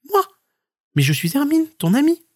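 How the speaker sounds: high, cheerful stoat voice; stoat character. Acted. Stoat reassures the scared marmot, high and cheerful. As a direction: pitch it up like a small friendly animal.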